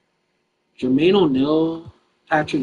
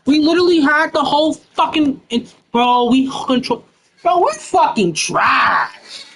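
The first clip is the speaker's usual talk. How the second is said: angry rant; 10 semitones higher than usual. Real, not acted. Angry rant about blown lead.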